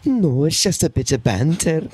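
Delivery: slow put-on Scottish accent; Scottish woman. Woman answering the interviewer, slow Scottish accent. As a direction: deny it in a mock Scottish woman's voice. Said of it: "doing an impression of famous comedian Will Sasso".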